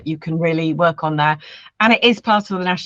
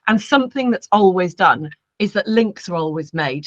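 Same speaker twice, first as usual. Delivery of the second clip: ordinary talk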